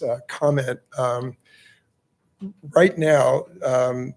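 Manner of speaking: ordinary talk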